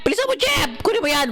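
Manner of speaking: high, frantic urging; squeaky panicked hurry-up; urgent cry. Real, not acted. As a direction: hurry the dog along, voice cracking high. Urging dog, voice jumps high.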